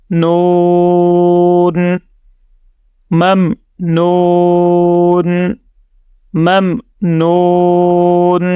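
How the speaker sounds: ممنون with a very long oo before final n; stretched noon. Shown as a demonstration. Teacher reads the word in pause, ممنون drawn out on noo. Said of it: "نو کو ہم نے stretch بھی".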